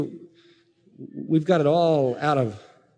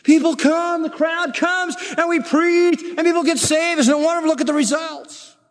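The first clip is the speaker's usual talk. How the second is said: high, excited mimicking of defenders; enthusiastic objectors; 12 semitones higher than usual. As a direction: imitate people gushing over their success. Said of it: "And they say".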